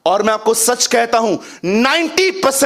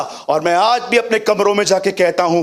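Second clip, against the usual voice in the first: usual speaking voice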